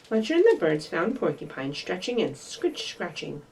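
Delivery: plain reading voice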